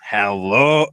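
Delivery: low, oily hello; lightly voiced creepy greeting; low-pitched creep's entrance; Noam Dar. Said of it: "Noam comes in and goes".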